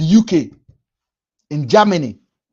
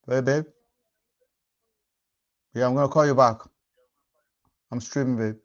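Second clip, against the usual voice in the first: hushed and softer, off to the side; quiet phone aside; 6 semitones lower, 7 dB quieter, faster than usual. Real, not acted. Streamer briefly answering his girlfriend on the phone, hushed. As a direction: drop it low for a private call.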